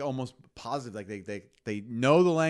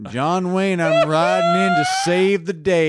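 loud, higher-pitched hammed-up cowboy hero; John Wayne; 6 semitones higher, 13 dB louder than usual. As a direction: belt out a mock Western cowboy boast. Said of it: "That used to be American".

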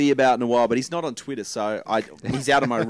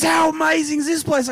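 Aussie bloke; 4 dB louder than usual. Retold or imitated. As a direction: ham up a freezing Aussie backpacker. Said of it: "He goes".

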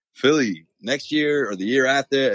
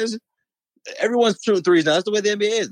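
much higher-pitched acted objection; mock protest; 10 semitones higher, faster than usual. Fan arguing back at the basketball gods, high mock protest. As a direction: protest in a raised, put-on voice.

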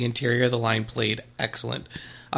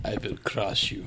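softer menacing Russian boxer voice; Ivan Drago; 3 semitones lower, 7 dB quieter than usual. Acted. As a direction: menace like a cold boxer villain. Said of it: "the Ivan Drago to my, uh, Rocky".

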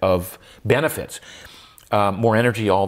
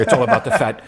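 put-on light South African accent, laughing; a hint of South African; 3 dB louder, faster than usual. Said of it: "I try to do the South African accent here".

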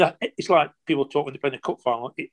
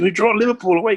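higher and louder, thrilled to share big news; excited announcement; 7 semitones higher, 6 dB louder than usual. Retold or imitated. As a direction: burst out with the news of the draw. Coach excitedly announces the draw.

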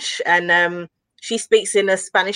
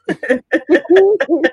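higher-pitched burst of laughter; real laugh; 8 semitones higher, 4 dB louder than usual. Real, not acted. Co-host hearing the Hilarious Baldwin name, real laughing. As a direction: crack up at a silly name.

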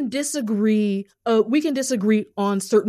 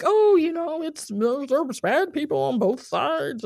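mocking drawn-out singsong, starting high; both-sides fence-sitter; 4 semitones higher, slower than usual. Guest mimics people who blame both sides, mocking singsong. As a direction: draw it out like a wishy-washy fence-sitter. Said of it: "one of those people that's like".

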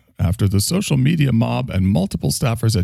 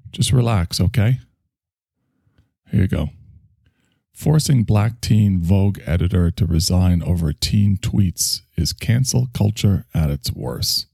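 ordinary talk, nothing marked

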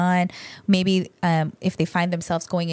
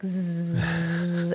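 bee voice; 2 semitones lower, 4 dB quieter than usual. Shown as a demonstration. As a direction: buzz out the breath like a bee. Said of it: "you sound like a bumblebee".

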